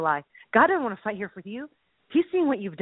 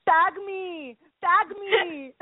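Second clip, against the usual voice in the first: high, drawn-out mock plea; hammed-up movie wrestler begging; pleading wrestler; 6 semitones higher, much slower than usual. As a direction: call out to be tagged in. Acting wrestler begging for tag.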